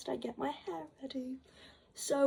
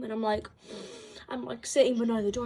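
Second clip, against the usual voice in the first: ordinary talk, nothing marked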